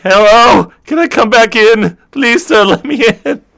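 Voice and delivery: put-on a locked-out young crewman begging to be let in; pleading high voice. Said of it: "knocking on the door like".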